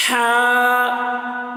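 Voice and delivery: ہا held long from the open throat; drawn-out letter name. Shown as a demonstration. Teacher shows students the letter ہا, drawn out. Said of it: "دیکھیے آواز".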